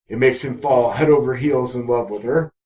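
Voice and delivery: ordinary talk, nothing marked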